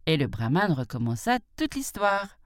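ordinary delivery

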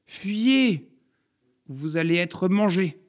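high, drawn-out alarm; old prophetess foretelling doom; cry of warning. A narrator's reading. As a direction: wail the warning to everyone. Old courgette cries out a warning.

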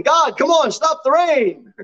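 high, drawn-out whine to God; mock complaining. People complaining to God about too much rain, high mock whine. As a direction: plead in exasperation, pitch way up. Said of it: "then we complain".

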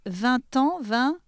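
Vingt ans with a clear T liaison, then vingt on its own; teacher's model. Shown as a demonstration. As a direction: say Vingt ans slowly for the class to repeat. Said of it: "on répète après moi". (modèle de prof à répéter)

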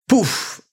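high sharp pouf; puff sound effect. A narrator's reading. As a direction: pop it like smoke.

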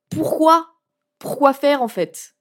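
high, loud indignant why; outraged questioning. Real, not acted. Outraged at prescribing pills.

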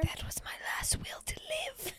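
high, breathy, voiceless whisper; whispered mock despair. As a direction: whisper it like the last dying thought of a bored man. Voicing Justin's despair as a joke.

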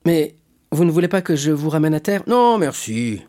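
plain reading voice

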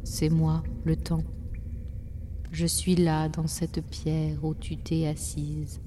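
voix du temps, plus basse et lente; un vieux temps calme qui parle doucement; le temps, voix douce et grave. Acted. Narratrice joue le temps. (le temps parle à la chenille, voix grave et posée)

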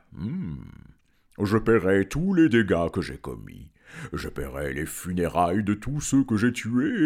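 slow, drawn-out dragon voice; pleading dragon. Acted. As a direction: beg and bargain as a cornered dragon. Dragon pleading for his life. (voix de dragon suppliant, lente et traînante)

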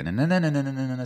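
high, loud sing-song nanana, mimicking memorised delivery; too recited. Shown as a demonstration. Comedian showing how a novice's memorised text sounds, over-recited sing-song. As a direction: parody a learnt-by-heart recital on one high, sliding tone. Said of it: "ça sonne un peu trop récité".

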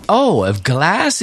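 amused American barman catching on; barman's American "glass". Retold or imitated. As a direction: mimic the barman finally getting it, slow and high. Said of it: "il me dit".

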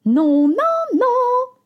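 high, loud, slow little bread voice; tiny runaway bun squealing no; little simit crying no. A narrator's reading. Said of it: "Et le simite dit".

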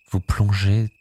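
ordinary talk

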